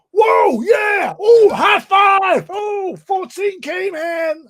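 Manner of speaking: high, whooping mock-American cheers; hyped-up Americans. Englishman mimicking three Americans boarding the coach, hyped-up and whooping. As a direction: whoop it up like boisterous thrill-seekers. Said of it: "like, really hyped up".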